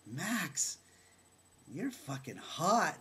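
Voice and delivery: high, loud retold amazement; astonished recognition. Teller finally recognises Max, astonished. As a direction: blurt it out in admiring shock.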